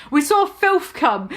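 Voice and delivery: plain reading voice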